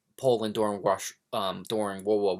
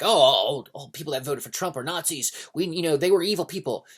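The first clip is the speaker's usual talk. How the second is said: higher, mocking singsong of their views; mocking quote; 5 semitones higher, 4 dB louder than usual. Speaker mocks people calling Trump voters Nazis, sneering. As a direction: parody the other side with a sneer. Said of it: "the people that were like".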